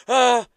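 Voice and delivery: loud, high gasp of fright; sudden re-enacted shriek of shock; startled gasp. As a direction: act out a jump-scare. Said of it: "nearly had a fucking heart attack".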